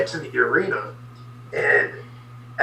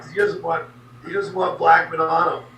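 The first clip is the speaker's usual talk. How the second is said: ordinary delivery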